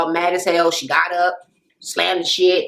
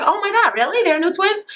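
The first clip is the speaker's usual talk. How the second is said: high-pitched, incredulous questioning; retold surprise; 9 semitones higher than usual. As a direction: act out a friend hearing surprising gossip. Retold reaction to gossip news.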